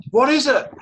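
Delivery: eager friend. Pretend. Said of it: "friend asks eagerly".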